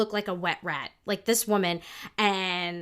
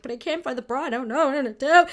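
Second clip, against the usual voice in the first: retold crying; 5 semitones higher, 8 dB louder than usual. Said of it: "And I stopped crying".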